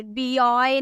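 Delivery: बॉय misread as "bee-oy"; wrong spelled-out reading. Shown as a demonstration. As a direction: say the letter B, then "oy", for बॉय. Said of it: "नहीं पढ़ेंगे".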